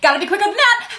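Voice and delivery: loud, high-pitched squeal; comic self-quote. Retold or imitated. Employee dodging customers round the corner, comic squeal. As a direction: squeal it in a silly rush. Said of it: "I'm like".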